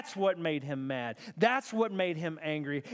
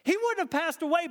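high, impassioned reproach; passionate; 9 semitones higher, 3 dB louder than usual. Retold or imitated. Martha saying this to Jesus, passionate. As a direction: plead it with passion, pitch well up. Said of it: "the passion on her lips".

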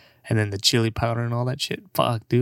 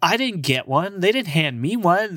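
mock whiny self; 8 semitones higher than usual. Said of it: "I was like".

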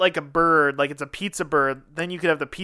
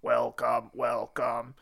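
low, soft machine greeting; robot voice; 7 semitones lower, 8 dB quieter, much slower than usual. Retold or imitated. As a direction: drone it like a robot. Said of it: "in a robot voice".

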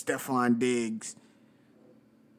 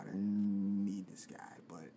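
hushed, half-voiced aside; whispered aside; 3 semitones lower, 10 dB quieter than usual. Real, not acted.

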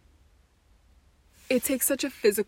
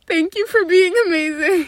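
fighting back crying; holding back tears; 6 semitones higher, 5 dB louder than usual. Real, not acted. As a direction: voice breaking, close to tears. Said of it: "I don't wanna start crying".

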